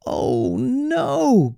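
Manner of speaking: high mock wail; a little girl's hammed-up cry; girlish wail. Goldilocks wails as the chair breaks, high mock wail. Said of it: "Goldilocks wailed".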